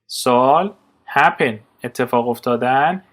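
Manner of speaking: normal talking voice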